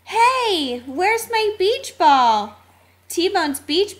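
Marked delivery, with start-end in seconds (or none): 0.1-2.6 high, stretched-out cry of alarm; alarmed exclamation. A narrator's reading. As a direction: call out in sudden alarm. T-Bone finds his ball gone.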